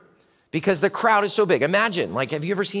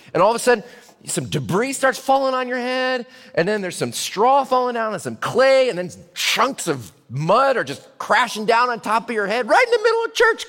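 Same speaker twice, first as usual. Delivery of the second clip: building excitement; 6 semitones higher than usual. Acted. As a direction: paint the chaos, building to a near shout. Vivid retelling of debris falling.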